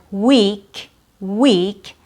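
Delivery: WEEK with a long, held ee; proper long E. Shown as a demonstration. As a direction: stretch the vowel in week. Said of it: "that's the proper way".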